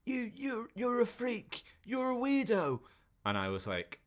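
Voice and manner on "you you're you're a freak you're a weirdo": high-pitched mock heckling; mock shouting. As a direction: jeer it much higher, without full volume. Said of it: "like, shouting at him".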